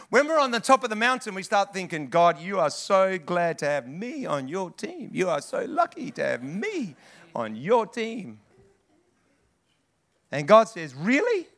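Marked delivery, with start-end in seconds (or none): 2.1-8.4 slow, swooping, self-satisfied praise of oneself; smug self-importance. Acted. Proud believer telling God his luck, smug. Mocking pride on the mountaintop. 10.9-11.5 high rising, incredulous query; skeptical question. Acted. God answering a proud believer's boast, skeptical.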